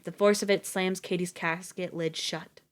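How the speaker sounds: ordinary delivery, nothing marked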